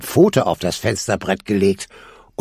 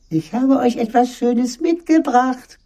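very high, coaxing wolf-as-mother voice; gentle disguised voice. A narrator's reading. Wolf tempts hesitant kids with a gift, high gentle voice. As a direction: lure them sweetly in high falsetto. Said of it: "rief die sanfte Stimme".

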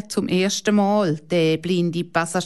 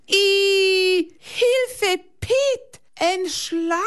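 very high panicked cry for help; terrified scream; 12 semitones higher, much slower than usual. A narrator's reading. Girl screams to her uncle about a snake, very high and panicked. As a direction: shriek as if a snake is at your feet. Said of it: "schreit Hanna plötzlich auf".

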